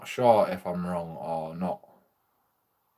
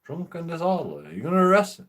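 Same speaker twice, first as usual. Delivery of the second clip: pleading disbelief; 6 semitones higher, faster than usual. Retold or imitated. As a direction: beg the officer in disbelief. I implored him.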